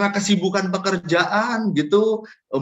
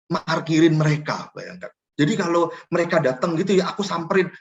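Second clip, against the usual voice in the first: ordinary talk, nothing marked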